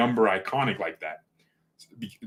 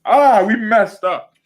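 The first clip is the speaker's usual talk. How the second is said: loud, high, drawn-out wail of regret; real exasperated holler; anguished outburst; 12 semitones higher, 11 dB louder, slower than usual. Real, not acted. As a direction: cry out in exasperated frustration. Lamenting the club's transfer mistake.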